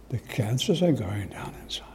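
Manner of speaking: hushed, unvoiced, in disbelief; awed whisper. Repeating the doctor's news to the congregation, in an awed whisper. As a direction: breathe it out like a secret miracle.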